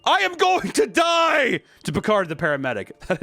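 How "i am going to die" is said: high, slow, strained mock yell. Dying student yelling to paramedic, high drawn-out shout. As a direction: cry out that you're about to die.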